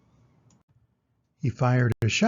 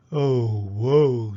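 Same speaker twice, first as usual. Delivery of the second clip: wolf lament; 4 semitones lower, 5 dB louder than usual. Acted. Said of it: "said the wolf".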